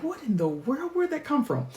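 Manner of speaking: high, hushed mock surprise. Catching her own country slang, mock surprised. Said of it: "and I'm like".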